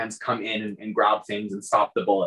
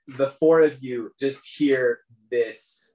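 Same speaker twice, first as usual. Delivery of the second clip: ordinary talk, nothing marked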